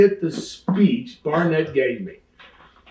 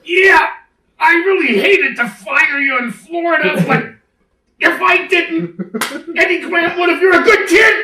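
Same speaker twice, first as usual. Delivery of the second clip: high, loud, strained apology through gritted teeth; a hammed-up forced apology through a biting tongue; 12 semitones higher, 4 dB louder than usual. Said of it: "he's biting his tongue".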